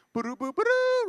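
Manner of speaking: sung-out high "boo-doo" jingle; TV fanfare imitation. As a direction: mimic a TV intro fanfare with your mouth. Imitates a TV jingle before announcement.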